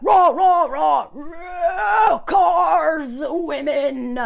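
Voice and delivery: loud mocking grunts of raw, raw; mock caveman grunting. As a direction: ham up a dumb brute shouting. Mocking caveman-type men.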